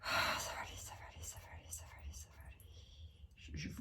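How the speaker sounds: soothing whispered repetition to herself; soft self-reassuring whisper. Real, not acted. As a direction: whisper it over and over to calm yourself. Calming herself, reading cards.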